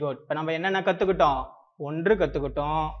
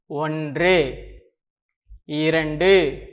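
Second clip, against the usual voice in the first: spaced-out counting; 3 semitones lower, much slower than usual. Shown as a demonstration. Teacher leading children who count along, measured and spaced. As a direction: count evenly with a long pause after each number.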